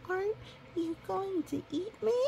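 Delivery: high, small scared pup voice; frightened pup. Acted. Pup afraid of the polar bear, a high scared voice. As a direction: ask it like a tiny frightened pup.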